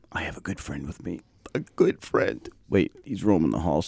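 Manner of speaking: quiet aside. Real, not acted. Host drops voice, aside.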